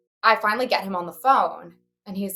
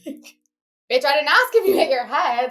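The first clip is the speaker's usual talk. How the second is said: higher-pitched mock outrage; exasperated retort; 7 semitones higher, 3 dB louder, slower than usual. Daughter answering dazed dad on the phone, exasperated. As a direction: snap back in comic exasperation, pitched up.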